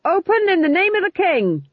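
high, loud bellowed command; official shout. A narrator's reading. Sergeant knocking at a cottage door for the king, shouting. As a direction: bellow it through a closed door. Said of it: "Why did you shout like that?".

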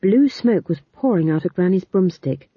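ordinary delivery, nothing marked

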